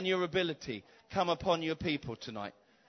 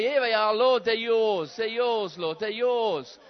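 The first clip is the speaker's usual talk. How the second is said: loud, high cry of surrender; loud offering prayer; 3 semitones higher, 7 dB louder than usual. Pretend. Believers giving their hands to God, loud surrendering cry. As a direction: hand it all over out loud.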